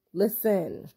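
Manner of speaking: ordinary talk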